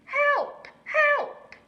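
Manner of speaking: very high, loud cry of help; yelling for help. A narrator's reading. Little owl fakes distress to the horse, yelling. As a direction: shriek for help like it's life or death. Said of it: "he yelled".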